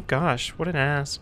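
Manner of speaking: soft, slow muttered insult; muttered aside. Real, not acted. Gamer grumbles after being brushed off, muttered. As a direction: grumble it under your breath.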